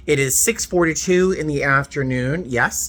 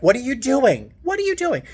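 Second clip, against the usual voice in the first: exasperated outburst; 12 semitones higher than usual. Retold or imitated. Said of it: "'Cause, like".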